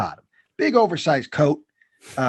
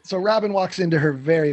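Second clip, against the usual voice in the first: ordinary talk, nothing marked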